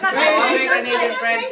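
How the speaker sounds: slow, loud formal address; grand announcement. Acted. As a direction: open the speech like a host. Addressing guests, speech opening.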